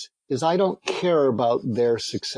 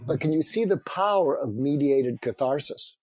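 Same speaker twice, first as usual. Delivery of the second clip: usual speaking voice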